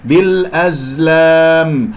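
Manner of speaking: بالأزلام very slow with a long, open "laam"; correct full alif. Shown as a demonstration. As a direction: hold a straight long a in بالأزلام. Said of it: "هكذا". (تلاوة بالأزلام ببطء بألف صحيحة ممدودة)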